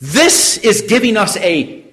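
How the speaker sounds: high, loud sermon delivery; impassioned preaching. Real, not acted. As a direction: drive the point home to the congregation. Preaches at raised force.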